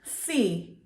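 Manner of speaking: ordinary delivery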